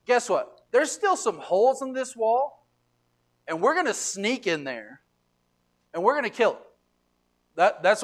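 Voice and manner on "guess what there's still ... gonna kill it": high, loud gloating threat; angry threat. Retold or imitated. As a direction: taunt them about the holes. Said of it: "so here's what they say".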